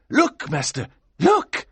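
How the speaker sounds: second look leaps high and loud; urgent call. Acted. Assistant alerting master, urgent high look.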